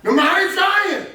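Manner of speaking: high, loud preacher's exclamation; emphatic shout. Real, not acted. Teacher echoing the reader to the class, high emphatic shout. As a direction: shout it out in triumph.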